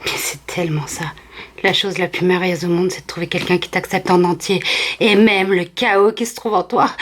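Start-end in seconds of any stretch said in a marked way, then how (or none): none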